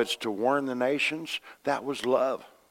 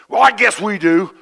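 loud, high mock-irritated retort; hammed-up grumpy comeback; a guy snapping back at the preacher; retold annoyed reply; 9 semitones higher, 11 dB louder than usual. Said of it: "get mad at me".

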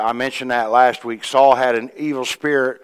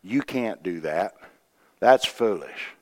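discouraging naysayer; 5 semitones lower, 8 dB quieter than usual. Retold or imitated. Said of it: "They'll try to discourage you".

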